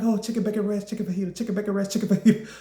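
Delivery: fast, frantic back-and-forth list; rapid dithering. Retold or imitated. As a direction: rattle the choices off in a panic. Torn between two menu options.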